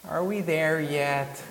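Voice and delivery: put-on high, drawn-out childish whine; imitated kid. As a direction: drag it out like an impatient kid. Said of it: "treat the kids who are like".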